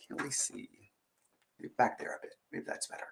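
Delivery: soft unvoiced whisper; whispered aside. Real, not acted. Reader whispering an aside about the pictures to listeners. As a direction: whisper it off to the side. Adjusting book for camera.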